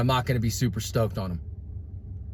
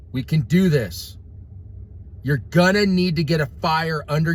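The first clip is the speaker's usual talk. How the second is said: raised, heated preacher voice; fiery preaching rant; 7 semitones higher than usual. Real, not acted. Ranting preacher fires up his viewers. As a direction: preach it hard to your followers.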